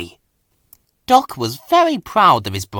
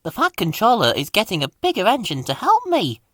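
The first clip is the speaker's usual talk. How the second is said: proud little engine, higher pitch; 8 semitones higher, faster than usual. A narrator's reading. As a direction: boast it with childlike pride. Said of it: "said Percy proudly".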